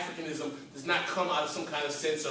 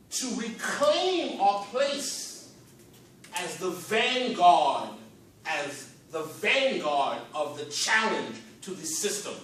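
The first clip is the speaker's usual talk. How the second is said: very slow, weighty words; slow oratory; slower than usual. Real, not acted. Speaker to crowd, claiming the vanguard, slow. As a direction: lean on each word like a rally speech.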